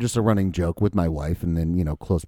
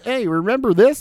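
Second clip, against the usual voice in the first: high-pitched, hyped-up reminder; mock excited; 13 semitones higher, 3 dB louder than usual. Co-host parodying a movie's nostalgia cue, mock excited. Acting out how a song is used.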